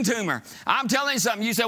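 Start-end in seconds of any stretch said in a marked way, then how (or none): none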